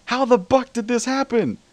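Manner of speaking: very high, loud outraged scream. A narrator's reading. Celestia in flames, screaming. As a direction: scream it at the top of your lungs. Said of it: "at the top of her lungs".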